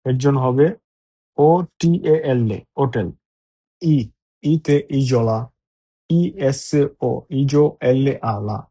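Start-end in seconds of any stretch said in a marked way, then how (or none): 2.8-3.1 হোটেল said o-tel, no h sound; silent h. Shown as a demonstration. Teacher confirms হোটেল as otel for students. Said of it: "ওইটার উচ্চারণ হয় না".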